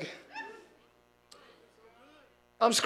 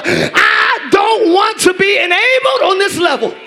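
loud, high preaching shout; fiery sermon delivery; full-force preaching; 8 semitones higher, 11 dB louder than usual. Acted. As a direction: preach it out hard to the room.

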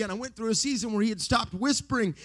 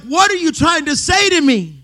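straining to hear; 9 semitones higher, 7 dB louder than usual. Retold or imitated. Steve straining to hear Ravenhill.

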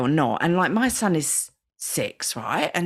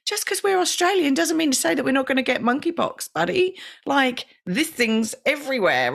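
pitched way up in retold alarm; startled mum; 10 semitones higher than usual. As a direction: catch yourself in mock shock, high. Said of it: "'Cause I was like".

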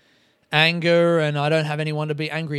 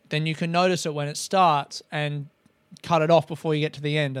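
normal talking voice

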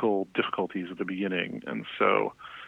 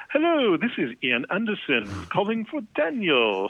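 much higher, slow, put-on voice leaving a voicemail; exaggerated high caller voice, barely hiding annoyance; 11 semitones higher, 3 dB louder than usual. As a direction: mimic a rock star's stiffly proper phone message. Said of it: "really annoyed sounding".

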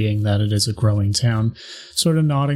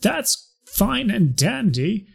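townsperson voice; 7 semitones higher, slower than usual. Acted. NPC argues with the player.

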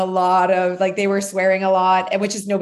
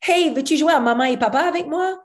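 put-on higher, quick little-girl voice; imitating a little girl; 7 semitones higher, faster than usual. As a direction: play the girl asking to play house. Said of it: "the little girl goes".

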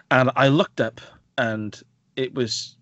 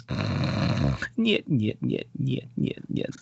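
Russian snoring; 4 semitones lower, 5 dB quieter, much slower than usual. Retold or imitated. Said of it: "the guy's falling asleep".

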